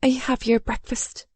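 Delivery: small, high, timid voice. A narrator's reading. Maid bringing breakfast to the Duke, very timid. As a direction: offer it meekly, afraid to intrude. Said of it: "Her voice even more timid".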